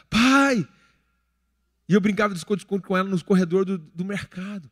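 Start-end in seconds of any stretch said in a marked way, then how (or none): 0.1-0.7 calling out. Retold or imitated. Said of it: "Aí ela começou a gritar".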